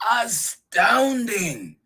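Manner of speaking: slow, high, loud amazement; marvelling storybook monster; astounded Gruffalo. Acted. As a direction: stretch the word out in wonder. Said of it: "said the Gruffalo".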